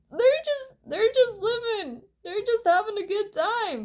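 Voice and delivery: a high, dreamy fan voice brushing off the question; high mock voice. Mock defence to friend.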